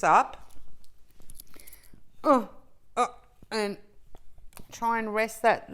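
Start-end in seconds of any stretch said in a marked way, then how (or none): none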